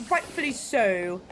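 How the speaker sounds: slow imitated British accent. Streamer explaining where the quote comes from, mock British accent. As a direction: say it slowly in a put-on British accent. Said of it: "entirely in a British accent".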